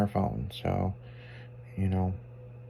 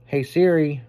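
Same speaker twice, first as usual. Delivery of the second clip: raised, clear summons; calling voice assistant; 4 semitones higher than usual. Real, not acted. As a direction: call out to wake the phone assistant.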